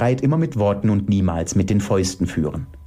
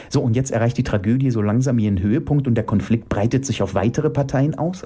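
usual speaking voice

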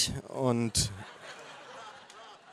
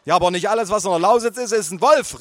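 mother's correction, higher and louder; calling out; 7 semitones higher, 5 dB louder than usual. Acted. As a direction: insist over the table noise. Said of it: "rief meine Mutter".

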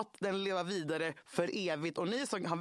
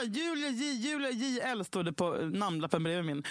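normal talking voice

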